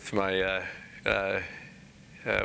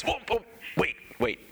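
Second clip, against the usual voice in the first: high-pitched imitated "whoa", halting everything; playful excited interruption; 8 semitones higher than usual. Columnist calls his wife to hear praise, playful excited interruption. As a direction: break in and call your wife over.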